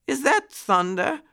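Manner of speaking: very high-pitched startled question; muskrat lady, startled. A narrator's reading. Muskrat lady hearing a rumble from her window, startled. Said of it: "asked the muskrat lady".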